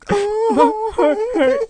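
very high, drawn-out mock wail; mumbled through tears. Heartbroken boy repeating it to his brother, hammed-up crying. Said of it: "I mumbled through tears".

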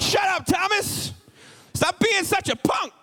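high, drawn-out mock shout; exasperated scolding. Preacher plays friend telling Thomas off, high mock-exasperated shout.

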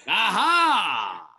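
a shouted, high aha; loud high exclamation over the flat joke. Real, not acted. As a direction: burst out with a big aha. Reacts to joke's reception.

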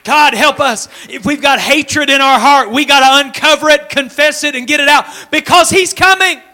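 loud, high, full-force preacher's cry; a revival preacher pressing the crowd; fiery preaching. Acted. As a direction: preach it out loud to the whole room.

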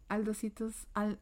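normal talking voice

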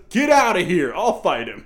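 loud hammed-up command; mock guard order. Retelling the guard's dumb line, loud mock order. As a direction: bark it like a bossy movie guard. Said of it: "And then goes".

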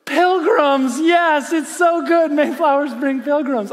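high, loud cheering over the joke; mock delight. Pastor relishing his son's terrible dad joke, mock delight. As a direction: celebrate a corny punchline at full pitch.

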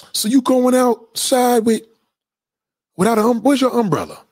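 a high mock voice for her question; imitating her question. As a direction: squeak it out like she did. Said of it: "That's how she said".

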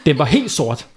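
usual speaking voice

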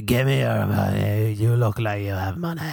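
mock aggressive demand for cash; aggressive beggar. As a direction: imitate a pushy panhandler, drawing the words out. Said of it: "They're getting so aggressive".

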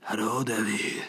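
slow, soft put-on dog voice; talking dog. Charlie the dog waking to owner, slow put-on voice. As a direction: imitate a dog greeting its owner, slowly. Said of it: "and he'd be like".

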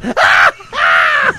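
wife shrieking with joy in the ER; gleeful shriek. Retold or imitated. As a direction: shriek like a kid with ice cream. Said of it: "She literally goes".

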